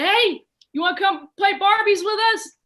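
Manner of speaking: high, loud playground invitation; calling out. Retold or imitated. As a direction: call to a kid across the meadow. Girls calling a boy over to play.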